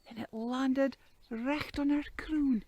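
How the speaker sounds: ordinary delivery, nothing marked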